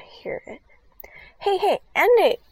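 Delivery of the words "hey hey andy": urgent high shout across the cave; calling out. Acted. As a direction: yell his name to stop him. Calling out to stop Andy.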